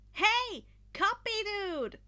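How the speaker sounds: high, loud shout across the room; calling out. Acted. Izuku calling to Monoma across the arena, loud. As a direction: hail him from a distance. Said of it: "Izuku called".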